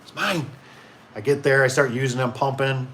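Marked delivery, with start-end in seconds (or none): 0.0-0.5 mock-greedy clutching the last pump; sneaking past a rival shopper, possessive. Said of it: "hiding it, as I walk past him".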